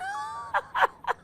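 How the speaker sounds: high delighted laugh; bright laugh breaking out; burst of laughter. Real, not acted. Laughing at the story.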